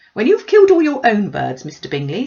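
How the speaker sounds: high, loud, breathless gossiping mother; gushing Mrs Bennet. Acted. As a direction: pour out the news like an excited busybody. Mrs Bennet voiced by narrator.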